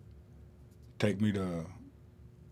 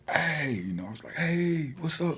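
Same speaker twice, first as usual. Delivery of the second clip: high, bright call across the hall; excited greeting; 10 semitones higher than usual. Retold or imitated. Inmate spotting his cousin across the hall, high and excited. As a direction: call out happily to a long-lost cousin.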